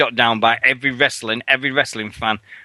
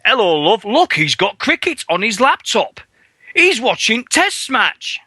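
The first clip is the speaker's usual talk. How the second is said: imitating a train chav; 9 semitones higher than usual. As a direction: ham up the cricket fan on the train. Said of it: "all you hear is".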